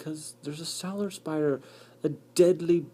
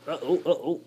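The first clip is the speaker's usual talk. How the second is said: ordinary talk, nothing marked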